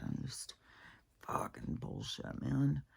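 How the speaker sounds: very soft, breath-only cursing; weary exasperation. Real, not acted. Speaker sick all the time, whispered weary cursing. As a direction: whisper it, worn out and fed up. Venting about years of sickness.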